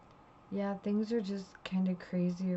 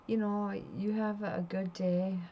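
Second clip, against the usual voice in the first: ordinary delivery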